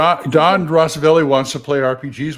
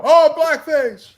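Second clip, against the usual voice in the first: mock outrage; 9 semitones higher, 5 dB louder, much slower than usual. Mocking outraged complaint.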